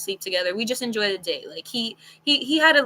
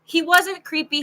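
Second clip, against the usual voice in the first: ordinary talk, nothing marked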